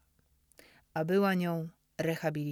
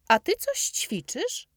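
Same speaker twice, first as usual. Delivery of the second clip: put-on high, loud, slow boyish question; Dominik's eager question; 13 semitones higher, 8 dB louder than usual. A narrator's reading. Dominik asks the narrator, high and loud. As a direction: ask it as an eager young boy. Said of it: "zapytał Dominik".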